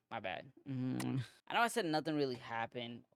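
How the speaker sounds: ordinary talk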